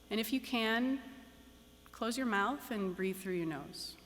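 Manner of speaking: calm, unhurried meditation guide with long pauses; guided meditation. Acted. Teacher guiding graduates into meditation, calm and measured. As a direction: settle the room gently, one instruction at a time.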